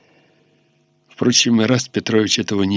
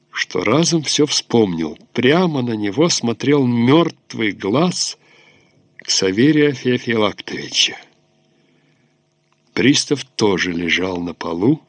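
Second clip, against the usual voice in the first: usual speaking voice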